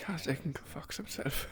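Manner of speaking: hushed whisper under the breath; whispered aside. Real, not acted. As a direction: mutter it so only the table hears.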